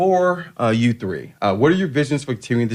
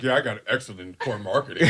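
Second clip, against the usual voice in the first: mocking imitation; 5 semitones lower, 5 dB quieter than usual. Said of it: "It's like".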